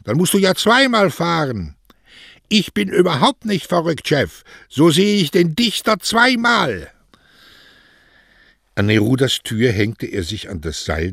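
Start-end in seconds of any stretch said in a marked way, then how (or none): 0.0-1.7 high, loud protest call; shouting after him. A narrator's reading. Boss objects to the cycling postman, shouting high. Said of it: "schrie Don Cosme". 2.5-6.9 very high, loud calling; shouting back. A narrator's reading. Cycling postman answers his boss, calling out exuberantly high.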